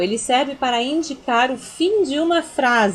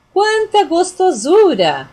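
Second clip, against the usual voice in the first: higher, louder, slow delighted cry; joyful exclamation; 6 semitones higher, 7 dB louder, slower than usual. Shown as a demonstration. Teacher showing joy at something tasty, delighted. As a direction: savor it with delight. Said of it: "eu tô mostrando uma alegria".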